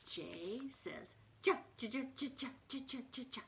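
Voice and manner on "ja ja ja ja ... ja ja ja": bouncy, repeated "ja, ja, ja"; staccato "ja" in quick hops; jumping J. Shown as a demonstration. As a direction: bounce short "ja" beats like jumping. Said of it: "He's jumping".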